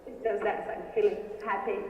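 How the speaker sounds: ordinary talk, nothing marked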